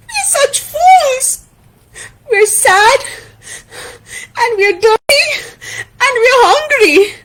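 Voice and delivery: very high, crying character voice; hammed-up squeaky weeping; Taín, tearful lament. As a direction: wail with sobbing breaths between phrases.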